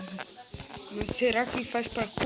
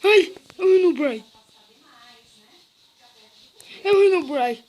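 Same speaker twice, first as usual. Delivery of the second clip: shouting in fright, louder; panicked yell; 10 dB louder than usual. Real, not acted. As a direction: yell it out in sudden fear. Spots the monster in game.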